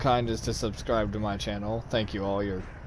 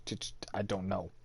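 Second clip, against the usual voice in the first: whispered aside; 3 semitones lower, 12 dB quieter than usual. Real, not acted. Exasperated aside about plants.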